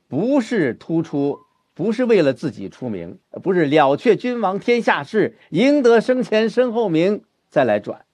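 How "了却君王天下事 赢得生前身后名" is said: high, ringing verse declamation; rousing recitation. Shown as a demonstration. Teacher demonstrates ambition before the turn, rousing recitation. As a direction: declaim the couplet high and proudly.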